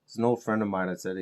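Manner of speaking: ordinary talk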